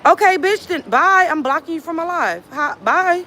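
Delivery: high-pitched, scornful goodbye; dismissive send-off. Real, not acted. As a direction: send them packing in a high voice. Blocking a commenter from the live.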